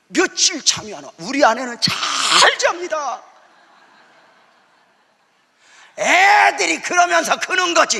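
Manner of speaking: high, loud, slow exclaiming; preaching exclaim. Acted. Pastor exclaims to the congregation about sleepless nights. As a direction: cry it out to the congregation.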